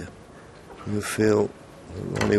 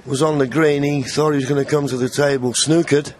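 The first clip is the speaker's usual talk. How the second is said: raised, higher commentator voice; excited commentary; 6 semitones higher, 4 dB louder than usual. Real, not acted. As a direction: lift the energy as the frame is won. Commentator reacts as the frame is sealed.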